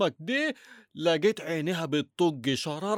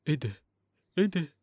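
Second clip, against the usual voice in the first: ordinary talk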